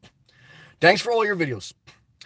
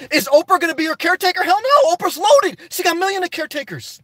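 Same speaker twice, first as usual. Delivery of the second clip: heated rant; 7 semitones higher than usual. Real, not acted. Ranting about Oprah caretaker.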